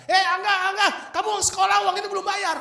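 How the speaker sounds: high, loud public shout; shouting accuser; calling out. Retold or imitated. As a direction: yell his name across the yard to shame him. Staff calling out debt.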